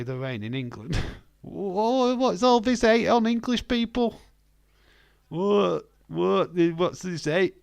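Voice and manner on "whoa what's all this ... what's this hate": high, loud indignant protest; mock outrage. Streamer answering jibes at English people, loud high mock outrage. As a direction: protest loudly, pitched right up.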